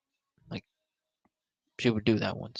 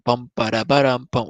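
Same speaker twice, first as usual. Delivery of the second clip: louder sung ringtone notes; imitated ringtone; 7 dB louder than usual. Mimicking his iPhone ringing at midnight. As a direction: sing the phone ringing. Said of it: "iPhone ringing".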